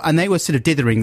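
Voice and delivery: usual speaking voice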